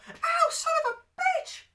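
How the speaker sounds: put-on very high, slow squeaky kid cursing; a tiny boy yelping a curse; squeaky child voice. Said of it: "that is how I sounded".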